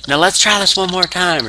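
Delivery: high-pitched mock promo voice; Stone Cold Steve Austin. Wrestler offering the beer to his rival a second time, mock insistent. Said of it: "and he's like".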